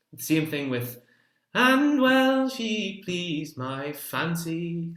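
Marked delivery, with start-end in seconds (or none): 1.5-4.9 pleased and fancy leaned on, louder; emphasis on pleased and fancy. Shown as a demonstration. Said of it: "it just emphasizes".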